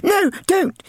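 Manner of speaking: put-on very high, pleading child; pleading girl cry. Little sister begging her brother, high plea. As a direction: beg him to stop. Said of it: "she cried".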